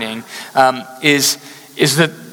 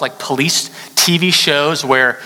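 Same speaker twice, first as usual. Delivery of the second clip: ordinary delivery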